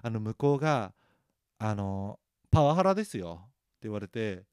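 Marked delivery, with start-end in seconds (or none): 2.5-3.4 loud, raised retort imitating a junior; indignant accusation. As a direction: call out the senior with mock outrage. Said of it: "目ひんむかれて言われたら". (強く訴える後輩の真似)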